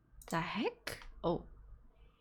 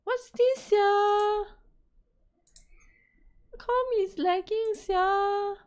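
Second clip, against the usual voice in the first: much higher, whiny and loud; frustrated whine; 12 semitones higher, 5 dB louder, slower than usual. Real, not acted. Streamer whines at her lagging computer, much higher.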